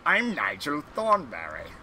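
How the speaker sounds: exaggerated British cartoon voice, pitch swooping; plummy British caricature; Nigel Thornberry. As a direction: put on a cartoonish British character impression. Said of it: "kinda reminds me of Nigel Thornberry".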